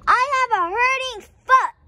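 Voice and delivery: high, loud, fast yell; child yelling down the street; shouting from far. A narrator's reading. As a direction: shout back from way behind. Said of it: "so I had to shout".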